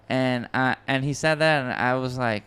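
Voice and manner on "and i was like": ordinary talk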